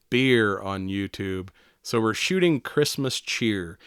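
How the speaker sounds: drawn out slowly, savoring the pun; slow punchline. Retold or imitated. YouTuber explaining the beer targets, slow deliberate punchline. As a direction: spell out the joke slowly.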